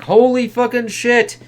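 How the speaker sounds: stunned exclamation. Acted. Reviewer reacting to a gory panel, mock shocked. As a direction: gasp it out in disbelief.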